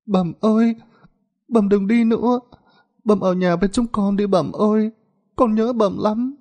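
put-on a sick little boy whimpering for his mother; whimpering child. A narrator's reading. Sick son begging his mother not to leave, whimpering. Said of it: "Nó mếu máo".

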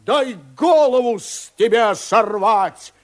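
high, loud threatening yell; shouting. A narrator's reading. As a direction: bellow it at the enemy. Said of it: "кричит Фарлав".

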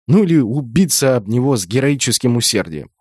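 ordinary delivery, nothing marked

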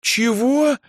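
high, outraged "what?"; affronted girl's exclamation; indignant. A narrator's reading. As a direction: bristle at the insult, pitch leaping. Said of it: "возмутилась Эльза".

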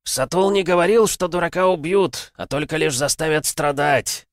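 high, loud monstrous challenge; zombie king voice. Acted. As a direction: menace like a gloating monster. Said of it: "сказал король зомби". (голос короля зомби)

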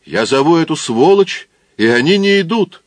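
high, loud bitter cry; angry outburst. Acted. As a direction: spit out the insult. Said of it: "я сказал".

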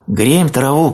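very high storybook call; little bear's mild, high shout; bear cub shouting back. A narrator's reading. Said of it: "крикнул медвежонок".

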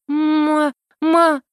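high stuttering from shock; stammer, too shaken to speak. A narrator's reading. Heroine stammering at the magister, high shaken stutter. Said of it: "нормально говорить смогла не сразу".

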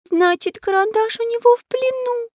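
put-on high, squeaky child's lament; tearful girl. A narrator's reading. Girl realising her friend is captive, tearful. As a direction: wail it in a little girl's voice. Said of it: "заплакала Настенька".

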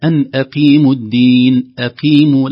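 recited loudly, "أقيموا" with a clear lightly heavy q; careful qāf. Shown as a demonstration. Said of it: "لاحظوا القاف". («أقيموا» بقاف واضحة)

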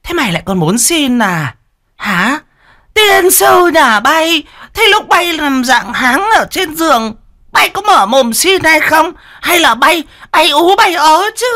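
high, loud shrill jeer; shrill mocking scold. A narrator's reading. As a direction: sneer and shriek at the girl. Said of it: "cái giọng choe choé".

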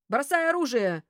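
megaphone command. A narrator's reading. Police ordering a strangler to drop his weapon, megaphone command. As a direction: thunder it out as if through a megaphone. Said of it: "прогремел над поляной усиленный мегафоном голос".

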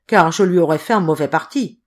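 plain reading voice

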